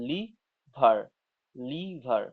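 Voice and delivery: "লিভার" split into লি and ভার; syllable by syllable. Shown as a demonstration. Teacher showing a two-syllable word, "লিভার" in two beats. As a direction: break "লিভার" after a long লি. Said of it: "এক্ষেত্রে দুটো পদাংশ রয়েছে".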